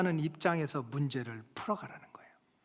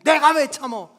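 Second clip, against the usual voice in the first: loud, raised-pitch retort; protesting child; 6 semitones higher, 9 dB louder than usual. Acted. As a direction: play an indignant son talking back. Older son protests to parent. (억울해서 대드는 아이 말투)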